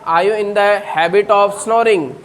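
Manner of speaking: slow, careful question for students; slow model question. Shown as a demonstration. Teacher models the question slowly for the class. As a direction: lay out each word slowly. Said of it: "तो question हो गया".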